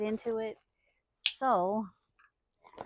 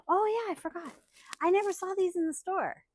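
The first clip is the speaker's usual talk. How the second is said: higher and louder, surprised delight; excited gasp; 8 semitones higher, 4 dB louder than usual. Real, not acted. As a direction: gasp then gush.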